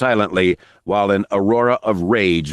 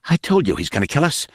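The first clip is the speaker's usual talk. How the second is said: hissed; 8 semitones higher, faster than usual. A narrator's reading. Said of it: "Nick hissed".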